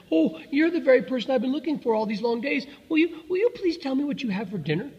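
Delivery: put-on high-pitched, eager young elephant; breathless excitement. A narrator's reading. Elephant's child meeting the crocodile at last, breathlessly excited. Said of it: "grew all breathless and panted".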